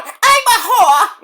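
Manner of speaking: high, drawn-out caricature; Missy Hyatt. Acted. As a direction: squeal it slowly as a bad impression. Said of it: "I can't do a Missy Hyatt impersonation".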